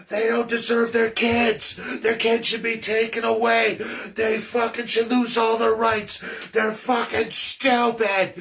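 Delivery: imitating the host's screaming tirade; mock furious yell. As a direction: rant like a furious radio host. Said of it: "And he's going off".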